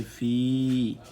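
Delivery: "fi" drawn out on a long, loud i; lengthened vowel. Shown as a demonstration. Teacher summing up the allongement rule, long held vowel. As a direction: hold the i of "fi" for a long beat. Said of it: "l'outil d'allongement de la voyelle i, ça devient".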